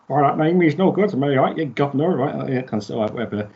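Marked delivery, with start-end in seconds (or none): 0.1-2.4 hammed-up camp British accent, a bit higher; mock pantomime British villain voice. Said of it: "it just sounds so camp British".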